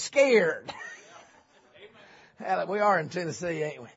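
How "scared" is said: "scared" said as "skeered"; hillbilly pronunciation. Shown as a demonstration. Preacher spelling it for Tennessee congregation, "scared" drawn into hillbilly "skeered". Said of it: "You spell that with a K and two E's".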